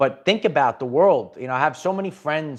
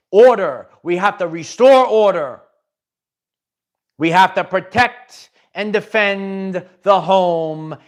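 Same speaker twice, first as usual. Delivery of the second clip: emphatic call; 2 semitones higher, 5 dB louder than usual. Real, not acted. Preaching order, emphatic.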